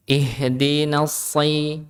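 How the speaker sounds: "Ihdinas si-" slow with a thick heavy s; full-mouthed s, not sh, in "Ihdinas si-"; correct shad. Shown as a demonstration. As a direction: darken the s into "si" in "Ihdinas si-".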